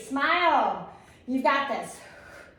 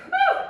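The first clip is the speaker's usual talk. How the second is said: whooping cheer; 10 dB louder than usual. Real, not acted. Cheering mid-countdown.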